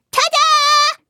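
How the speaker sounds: very high, loud stretched fanfare cry; jumping out to surprise a friend; triumphant tada. Acted. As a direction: burst out of the bush with a flourish. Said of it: "mit einem".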